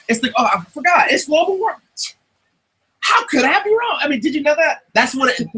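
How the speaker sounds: hammed-up sarcastic voice; sarcastic mock realization. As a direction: play dumb as if it just dawned on you. Sarcastic global warming mockery.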